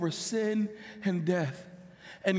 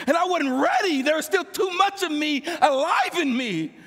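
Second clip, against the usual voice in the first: louder, higher, driving preacher voice; fervent preaching; 5 semitones higher, 6 dB louder than usual. Acted. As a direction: preach it with mounting force. Testimony rising to full preaching.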